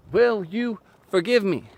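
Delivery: "Will you forgive me" pitched high and pressed hard; high loud earnest plea; emphatic plea. Shown as a demonstration. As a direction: plead it loud and high, then stop. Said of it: "You shut your mouth".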